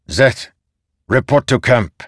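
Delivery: commanding officer dismissing a subordinate; stern general. Acted. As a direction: issue a firm military order. General orders Zeth away.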